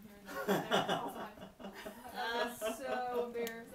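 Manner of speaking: laughing through the words; embarrassed laugh in the voice; laughing while talking. Real, not acted. Retelling an embarrassing moment.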